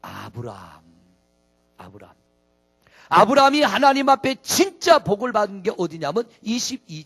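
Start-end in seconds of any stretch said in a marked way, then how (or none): 0.0-0.8 much lower, much softer name; low answer. Shown as a demonstration. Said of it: "누가 나올까요?". 1.8-2.1 very soft echo of the name; hushed echo. Shown as a demonstration. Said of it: "누가 나올까요?".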